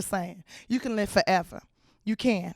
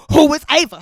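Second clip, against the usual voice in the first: much louder, pushy repeat of "Who is Ava?"; loud demand; 3 semitones higher, 12 dB louder than usual. Retold or imitated. Girlfriend demanding who Ava is, loud insistent yell. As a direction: bark "Who is Ava?" at him.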